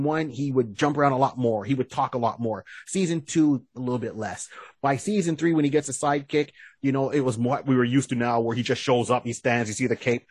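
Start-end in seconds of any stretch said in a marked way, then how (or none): none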